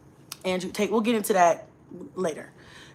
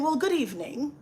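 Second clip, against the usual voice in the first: mock London accent, lilting upward; put-on London accent; 4 semitones higher, 5 dB quieter than usual. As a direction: playfully slip into a London accent. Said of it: "I don't know why I went into accent".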